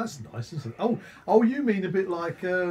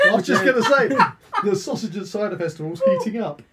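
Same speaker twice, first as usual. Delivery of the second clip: laughing, pitched up and loud; 7 semitones higher, 6 dB louder than usual. Real, not acted. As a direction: say it through laughter. Joking about hot sausage.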